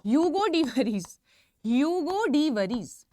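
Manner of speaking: slow, deliberate ह्यूगो डी वरीज, each syllable clear; careful pronunciation. Shown as a demonstration. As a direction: spell out ह्यूगो डी वरीज slowly for students. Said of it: "pronunciation important है". (धीरे और साफ़ उच्चारण)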